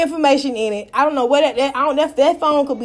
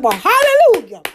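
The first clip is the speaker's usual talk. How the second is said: excited hallelujah; 6 semitones higher, 5 dB louder than usual. Retold or imitated. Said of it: "I said".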